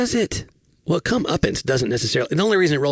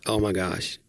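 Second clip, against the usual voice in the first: ordinary delivery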